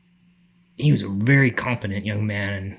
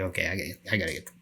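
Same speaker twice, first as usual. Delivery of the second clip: lower, much softer, fast under-breath urgency; low muttered urgency; 5 semitones lower, 12 dB quieter, faster than usual. Retold or imitated. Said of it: "finally I was like".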